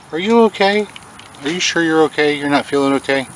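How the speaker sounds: asking a caterpillar if it is okay. Real, not acted. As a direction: coo worriedly at the caterpillar. Talking to caterpillar.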